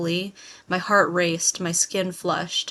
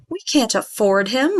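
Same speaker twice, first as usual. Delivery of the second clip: mother arguing; 4 semitones higher, 8 dB louder than usual. Retold or imitated. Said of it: "my mother had argued".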